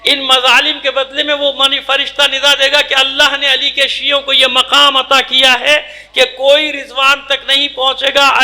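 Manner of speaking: ordinary talk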